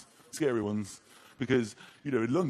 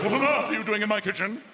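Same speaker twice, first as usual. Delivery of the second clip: raised, indignant posh English voice; unthreatening posh accent; 2 semitones higher, 4 dB louder than usual. Acted. Posh homeowner warning a kitchen intruder, prim posh accent. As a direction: scold feebly in a very posh accent. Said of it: "that's the least threatening accent".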